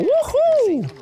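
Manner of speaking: high, held celebratory whoop; mock victory whoop; playful cheer. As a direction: let out a long high-pitched cheer.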